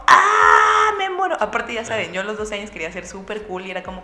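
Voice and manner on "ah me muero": loud cry of mortified excitement; twelve-year-old fan screaming in embarrassment; overwhelmed squeal. Retold or imitated. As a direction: burst out like a fan about to faint. Teen overwhelmed meeting her idol.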